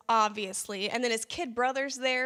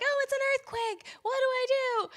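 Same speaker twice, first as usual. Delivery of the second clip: much higher, squeaky panicked quote; mock panic; 13 semitones higher than usual. Daughter on the phone to dad, mock panicked squeal. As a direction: play the frightened girl high and helpless.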